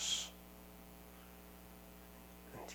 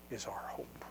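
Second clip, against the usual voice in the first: breathy hushed whisper, much softer; 6 semitones lower, 11 dB quieter than usual. Acted. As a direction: drop to a whisper on the hope. Hushed close of a sermon line.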